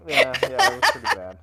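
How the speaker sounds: loud laughing through the words. Real, not acted. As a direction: laugh while admitting it. Laughing at own bad accent.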